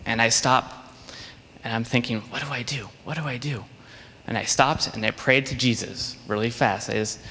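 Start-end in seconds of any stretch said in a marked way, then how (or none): 2.3-3.7 much softer, worried inner voice; anxious thought. Retold or imitated. Lost boy asking himself what to do, softer and worried. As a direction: hush it like a frightened thought.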